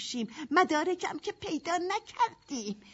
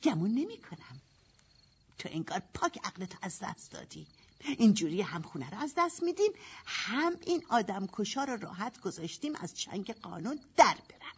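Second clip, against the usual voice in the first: normal talking voice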